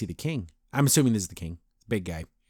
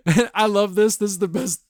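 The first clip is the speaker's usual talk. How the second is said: high, giggly delight; laughing delight; 8 semitones higher, 5 dB louder than usual. Retold or imitated. Reviewer relishing a favourite scene, laughing and high. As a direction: gush through laughter.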